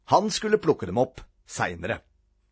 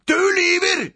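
higher, loud shriek; screamed accusation; 5 dB louder than usual. A narrator's reading. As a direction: scream the accusation at full pitch. Boy repeats accusation louder.

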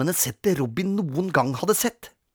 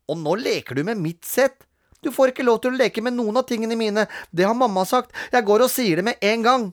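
high, fast, indignant protest; lightly voiced goody-goody boy; whiny little brother; 9 semitones higher than usual. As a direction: younger brother insisting on his rights. Peter threatens to tell.

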